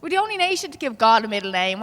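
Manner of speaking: usual speaking voice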